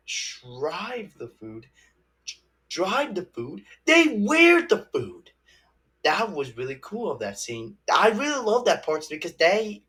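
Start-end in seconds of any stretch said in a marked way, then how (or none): 3.9-5.3 excited. Real, not acted. Excited retelling of scene.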